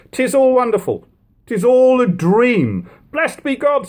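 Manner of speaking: high, slow, dreamlike wonder; awed stranger. A narrator's reading. Passer-by stunned at the deliverance, high and slow wonder. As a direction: marvel as if it can't be real. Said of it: "expressing their surprise".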